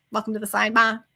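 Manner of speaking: put-on Boston-accented catchphrase, R dropped; quick Boston impression of a show greeting; Auntie Bev. Podcaster imitating an aunt figure's greeting, Boston accent. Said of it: "Auntie Bev sidebar".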